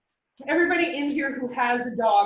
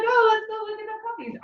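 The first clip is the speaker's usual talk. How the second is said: wheedling; 10 semitones higher, faster than usual. Retold or imitated. Coaxing husband toward the puppies.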